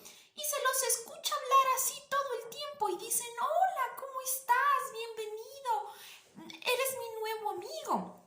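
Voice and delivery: put-on squeaky high voice, far above normal; high-pitched voice. Shown as a demonstration. Teacher imitating people who always talk high, very high-pitched. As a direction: greet in an exaggerated high register, drawing out words. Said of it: "un tono de voz bastante agudo".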